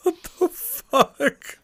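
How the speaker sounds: very high, drawn-out disbelief; slow, squeaky-high exclamation of disbelief; incredulous. Real, not acted. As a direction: stretch it out slowly in a high-pitched, baffled voice. Listener reacts to the retold video.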